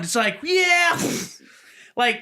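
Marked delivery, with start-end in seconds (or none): 0.4-1.0 mock cheer. Crowd cheering before blast.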